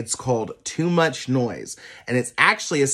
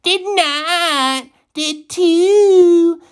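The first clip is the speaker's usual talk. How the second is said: put-on high childish squabble back and forth; high squeaky kid voices arguing; arguing children; 6 dB louder, much slower than usual. A narrator's reading. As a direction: bicker like little kids. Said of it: "argued the children".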